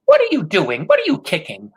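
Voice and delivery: high, loud scolding question; grandmother crying out. A narrator's reading. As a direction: scold him, stress on what. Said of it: "grandmother cried".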